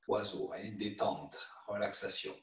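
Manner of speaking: hushed, settling instructor voice; soothing relaxation cue. Real, not acted. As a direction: lull them into relaxation, very softly. Guides students into relaxation.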